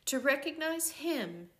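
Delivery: plain reading voice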